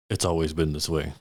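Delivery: low-pitched, matter-of-fact hobgoblin; lightly voiced low hobgoblin; hobgoblin character.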